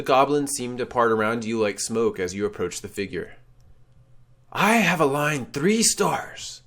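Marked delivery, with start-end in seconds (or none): 4.5-6.6 slow, higher, spectral pronouncement; ghostly declaration. Acted. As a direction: announce it like an ancient wraith. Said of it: "the ghostly man declares".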